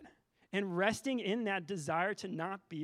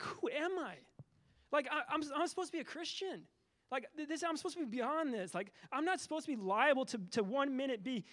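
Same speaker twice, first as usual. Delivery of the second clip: higher-pitched, retold soul-searching; anguished self-questioning; 7 semitones higher than usual. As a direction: reenact questioning yourself in dismay.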